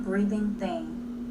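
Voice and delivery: ordinary delivery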